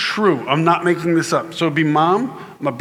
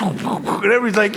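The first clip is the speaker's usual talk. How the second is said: usual speaking voice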